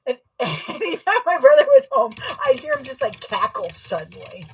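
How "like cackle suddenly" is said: usual speaking voice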